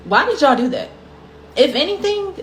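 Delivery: exasperated question, pitch jumping up; indignant. Real, not acted. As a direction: demand an answer in mock outrage. Indignant rhetorical complaint.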